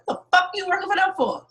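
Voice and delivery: a hint of an angry dad; imitating father.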